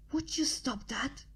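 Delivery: sharp hush with a hand over her mouth; hissed. A narrator's reading. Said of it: "I hissed".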